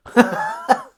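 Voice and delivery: big high laugh; high God laugh. A narrator's reading. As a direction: laugh loud at their boast.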